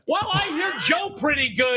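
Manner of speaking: high, hollered comic quote; mock hard-of-hearing shout. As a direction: call it out like someone hard of hearing. Quoting residents shouting over hearing aids.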